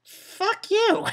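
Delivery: very high squeaky fuck you; mock rage. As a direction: spit it at the character in mock fury. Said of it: "I'm like".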